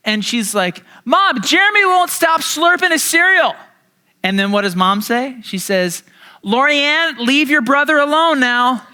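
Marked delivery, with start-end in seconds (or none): 1.0-3.5 high, loud whiny shout across the house; yelling for mom. Retold or imitated. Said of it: "my sister yells out". 6.4-8.9 higher, lightly voiced mom telling off; a mother calling from another room; mom's voice.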